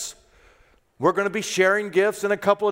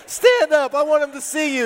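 higher, louder call to stand; 9 semitones higher, 4 dB louder than usual. Real, not acted. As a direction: call him up from across the room. Pastor calls man to stand.

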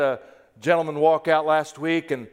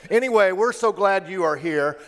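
ordinary delivery

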